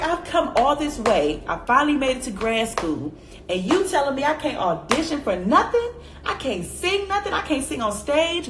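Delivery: retold upset outburst; upset rant. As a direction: vent like you've been wronged. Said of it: "I was really upset".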